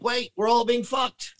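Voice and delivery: high, sudden dawning alarm; mock realization. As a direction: voice them realizing they've been had. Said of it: "And they're like".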